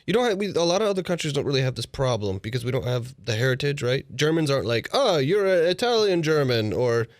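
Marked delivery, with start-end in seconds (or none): 4.9-6.7 lightly put-on Italian-German accent, pitched up at first; mock Italian-German accent. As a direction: toss off a vague, half-hearted Italian-German accent. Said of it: "What was that accent?".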